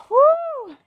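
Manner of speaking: high "woo" on an exhale; effort woo. Real, not acted. Instructor puffing through her workout, high effort woo. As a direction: blow out a high, airy woo.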